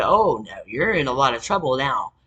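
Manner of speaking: mock threat. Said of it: "And he said".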